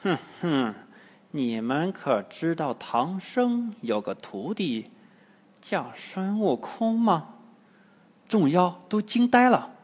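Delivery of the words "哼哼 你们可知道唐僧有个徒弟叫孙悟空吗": cold sneer. A narrator's reading. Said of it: "冷笑道".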